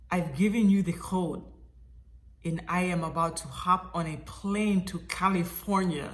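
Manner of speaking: put-on deliberate Russian-accented announcement; measured Russian accent, unhurried. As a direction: imitate a matter-of-fact Russian mover. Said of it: "in my best Russian accent".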